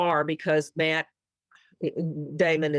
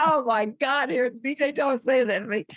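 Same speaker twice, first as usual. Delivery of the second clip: higher, giddy retold gasp of delight; starstruck excitement; 9 semitones higher than usual. Fan hearing her idol call her name, higher and thrilled. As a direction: relive the thrill, pitched up. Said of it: "And I was like".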